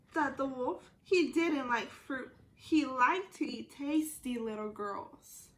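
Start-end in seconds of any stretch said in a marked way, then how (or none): none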